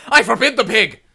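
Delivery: high, loud laughing proclamation; mock decree. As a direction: forbid it with hammed-up thunder. Mock decree banning the cartoon.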